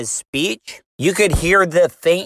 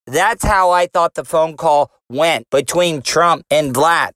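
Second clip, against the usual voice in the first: ordinary talk, nothing marked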